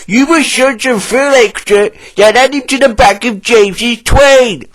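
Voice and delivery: plant manager voice. A narrator's reading. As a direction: command it as the manager. Plant manager gives orders.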